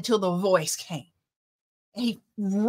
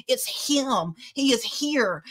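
normal talking voice

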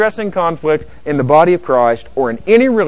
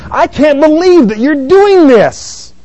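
loud, high, slow outburst; emphatic disbelief; 13 semitones higher, 8 dB louder, slower than usual. Acted. Nehemiah confronting nobles, emphatic and appalled. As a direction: throw up an outraged rhetorical cry. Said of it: "it's emphatic".

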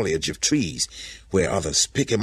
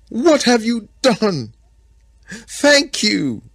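very high, loud cry of wonder; overwhelmed exclamation; 6 dB louder, much slower than usual. A narrator's reading. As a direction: cry out thanks in overjoyed disbelief. Adam overwhelmed on first seeing woman.

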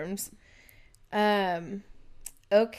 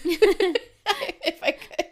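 laughing through words; giggling it out; 10 semitones higher, 5 dB louder, much slower than usual. Real, not acted. Laughing at her own joke.

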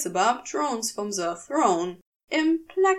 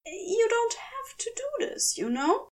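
a lighter, higher Aziraphale voice, anxious; blurted; 11 semitones higher than usual. A narrator's reading. Aziraphale blurting anxiously to Crowley, higher and tense. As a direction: blurt it out nervously, voice lifted. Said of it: "he blurted".

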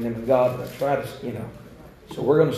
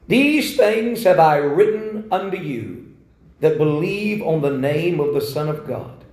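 scripture reading; 2 semitones higher, 4 dB louder than usual. Shown as a demonstration. Reads scripture.

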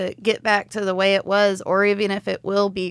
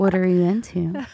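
sultry, teasing hotline voice; phone sex line voice; 3 semitones lower than usual. Pretend. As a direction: purr it like a sex hotline operator. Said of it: "I can do voiceovers as well".

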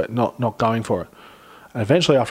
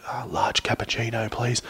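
soft hushed order; 2 semitones lower, 7 dB quieter than usual. Retold or imitated. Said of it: "he goes, like".

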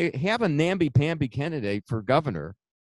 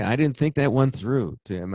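ordinary talk

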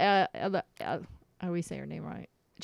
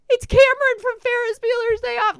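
high, loud, panicked fan gush; freaking out; 13 semitones higher, 6 dB louder than usual. Retold or imitated. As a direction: blurt it out, hyperventilating with excitement. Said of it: "freaking out".

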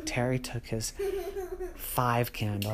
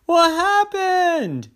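high, loud, slow panicked yell; yelling boy; 10 dB louder, much slower than usual. Acted. Terry calls into the dark house, high panicked yell. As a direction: cry out into the dark. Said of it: "he yelled".

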